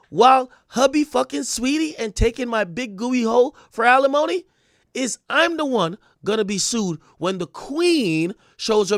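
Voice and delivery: high, theatrical diva taunt. Acted. Reading her post taunting a rival, high diva impression. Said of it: "you gotta read this in a [name] voice".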